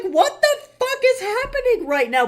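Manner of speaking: very high, loud squeal of disbelief; high-pitched, incredulous retold reaction; shocked outburst. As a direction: shriek it in hammed-up outrage. Retelling own shocked reaction to comments.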